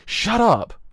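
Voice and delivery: explosive, high, very loud yell; angry shout. Acted. Doctor silences companion's coaxing over the monster, angry shout. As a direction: scream it to silence them. Acted quarrel, doctor snaps.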